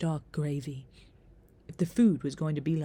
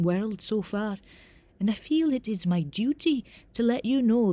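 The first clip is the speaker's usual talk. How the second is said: higher, louder Scottish professor explaining formally; reedy Scottish accent; 5 semitones higher, 6 dB louder than usual. A narrator's reading. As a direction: lecture a pupil in reedy Scottish tones. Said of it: "in a reedy Scottish accent".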